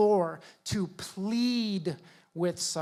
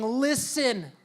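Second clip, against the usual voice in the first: higher, slow, earnest pleading; a higher, imploring plea; pleading Paul; 5 semitones higher, much slower than usual. Retold or imitated. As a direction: beg them not to miss it. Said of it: "saying, is begging them".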